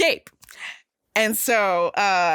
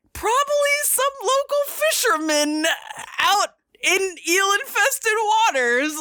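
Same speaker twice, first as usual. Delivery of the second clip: mock-high scornful villain voice, raised much higher; quoting Vizzini; 10 semitones higher than usual. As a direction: sneer it like a smug schemer. Vizzini quote in movie retelling.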